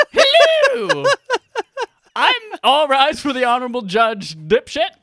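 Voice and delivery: high, loud, silly courtroom judge; honorable judge voice. Acted. Podcast host spins round to become the judge, high mock-judge voice. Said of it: "Watch me do a spin and become the judge".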